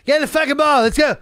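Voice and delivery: high, rapid-fire mock badgering; imitated pushy castmate. Castmate rounding up players at night, mock insistent yelling. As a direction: boss everyone into the game, as the castmate. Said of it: "he like".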